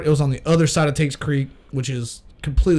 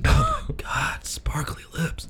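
slow whisper, drawn out; 6 dB quieter, slower than usual. Pretend. Joining the joke, whispering over a friend's lips. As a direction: swoon it under your breath. Continues mock flirting whisper.

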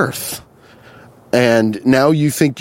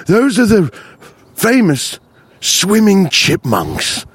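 nature documentary narrator; 6 semitones higher, slower than usual. Pretend. Nature narrator bit continues.

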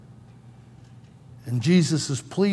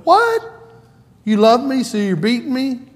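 high, loud mock outrage; a kid protesting his dad's logic; indignant kid protest; 7 semitones higher, 4 dB louder than usual. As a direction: squeal it like a wronged kid. Pastor voices himself as a kid.

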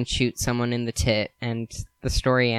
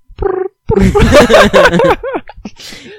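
pigeon noises; 12 dB louder than usual. Shown as a demonstration. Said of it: "Good pigeon noises".

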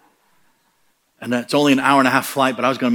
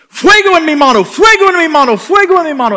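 much higher, much louder chant of amazement; repeated astonished cry, much higher and louder; excited cry; 9 dB louder than usual. Retold or imitated. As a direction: cry out as the hand burns with fire. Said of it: "all of a sudden the guy goes".

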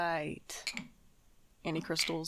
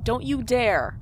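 warning shout; 4 semitones higher, 10 dB louder, slower than usual. Acted. Warning roommate.

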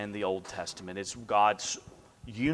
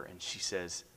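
ordinary delivery

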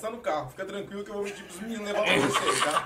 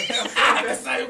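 laughing; 3 semitones higher, 6 dB louder, slower than usual. Real, not acted.

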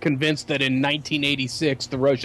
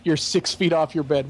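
ordinary talk, nothing marked

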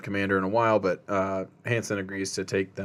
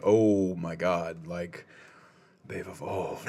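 hushed awe; 4 semitones lower, 4 dB quieter, much slower than usual. Retold or imitated. Hendrickson shaken by screamers.

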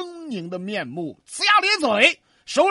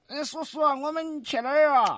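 put-on higher, hesitant child voice; timid little girl; 4 semitones higher than usual. A narrator's reading. Frightened girl asks uncle where they are going, timid. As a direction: ask timidly, pitched up. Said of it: "秀娥有点害怕呀".

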